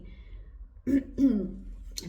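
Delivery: loud rough throat clear. Real, not acted. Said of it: "my throat's gone".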